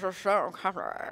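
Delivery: slow, drawn-out Scottish film star impression; Sean Connery. Shown as a demonstration. Guest attempting the actor's name for hosts, Sean Connery impression. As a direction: have a rough go at a famous Scottish actor. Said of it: "trying to be Sean Connery".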